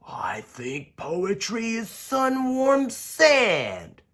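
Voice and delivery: slow, unhurried whisper; sleepy turtle whisper. Acted. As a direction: drawl it out in a whisper like an old turtle.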